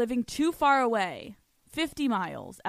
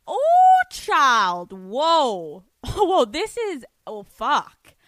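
real gasp of shock, much higher and louder; shocked reaction; 5 semitones higher, 10 dB louder, much slower than usual. Real, not acted. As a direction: caught off guard, blurting out loud. Stunned by listener's letter.

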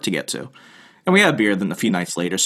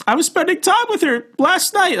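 much higher mock voice of a panicked friend; retelling a friend's call, imitating him much higher. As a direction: play the friend up high and fretful. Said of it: "He's like".

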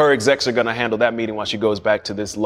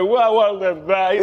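mock crying; 6 semitones higher than usual. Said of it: "I'm, like, bawling and crying".

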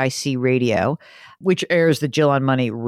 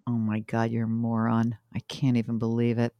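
much softer, low teasing; quiet disbelief; 4 semitones lower, 8 dB quieter than usual. Real, not acted. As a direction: drop it to a low teasing murmur. Mock disbelief at colleague.

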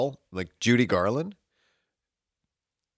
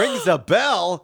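slow, loud disbelieving echo; incredulous; 9 semitones higher, 5 dB louder, much slower than usual. Retold or imitated. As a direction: repeat it in shocked disbelief. Outraged echo of his answer.

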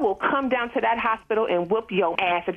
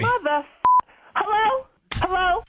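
indignant hello; 10 semitones higher than usual. Real, not acted. Caller hung up.

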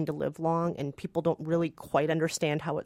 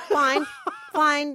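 mock-huffy fine; 7 semitones higher than usual. Real, not acted. Laughing concession.

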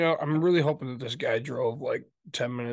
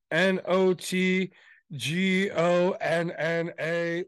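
slow spelled out letters; 4 semitones higher, 3 dB louder, much slower than usual. Retold or imitated. Spelling his fake name slowly.

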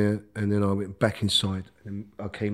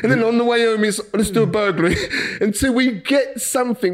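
laughing excitedly; 9 semitones higher, 4 dB louder than usual. Retold or imitated. Laughing retelling of the thieving spree.

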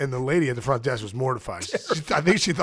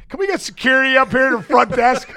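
laughing; 11 semitones higher, 4 dB louder than usual. Retold or imitated. Mock alarmed desk clerk, laughing.

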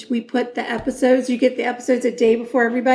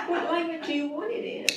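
laughing; 4 semitones higher, 11 dB quieter than usual. Real, not acted. Laughing at friend.

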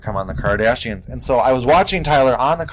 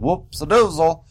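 playful whoops; 6 semitones higher, slower than usual. Retold or imitated. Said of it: "I'm like".

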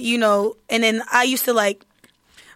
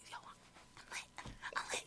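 hushed whisper, drawn out; 30 dB quieter, slower than usual. Retold or imitated. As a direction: breathe it out in a slow whisper. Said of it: "make you be like".

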